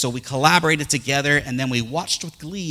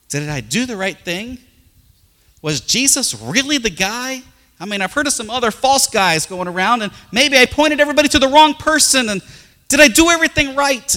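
rising, louder, worried questioning; anxious doubting John, building higher and louder; 8 semitones higher, 3 dB louder than usual. Retold or imitated. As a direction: voice John's growing panic. John's doubting inner voice.

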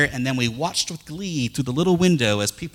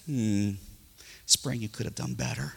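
much softer, low letdown; Riley, much softer and lower, scolding spring; disappointed mutter; 5 semitones lower, 12 dB quieter than usual. Retold or imitated. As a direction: sigh it out, let down. Disappointed daughter.

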